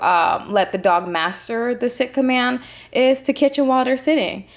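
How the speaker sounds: usual speaking voice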